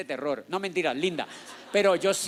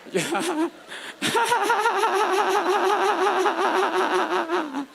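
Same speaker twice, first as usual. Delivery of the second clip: high, sustained pulsing cackle; drawn-out high cackle; long exaggerated laugh; 13 semitones higher than usual. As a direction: let a high laugh run on and on. Joking after admitting it was untrue.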